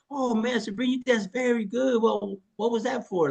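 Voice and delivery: high, gushing mock voice of the wife's friends. Wife's friends praising her dancing, high mock gush.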